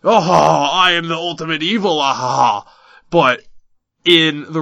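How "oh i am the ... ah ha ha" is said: hammed-up villain boast with a cackle; lightly voiced cartoon villain gloating; eighties anime villain. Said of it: "traditional eighties anime villain".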